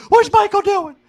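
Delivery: very high, loud mock gasp; a shrill imitation of a shocked critic; hammed-up outrage; mock alarmed liberal. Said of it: "the liberals would be like".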